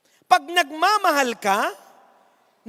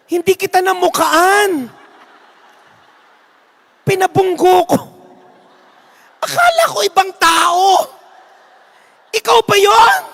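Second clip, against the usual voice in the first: astonished exclaiming; 5 semitones higher, 7 dB louder than usual. Acted. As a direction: stretch out the shock of recognition. Lord realizes mistake, exclaims.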